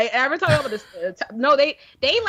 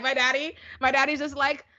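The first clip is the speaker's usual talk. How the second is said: ordinary talk, nothing marked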